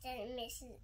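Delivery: plain reading voice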